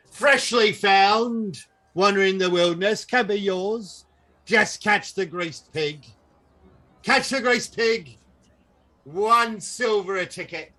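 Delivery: high, loud huckster pitch; carnival barker. Acted. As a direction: sell the prize to the crowd. Barker selling child prize.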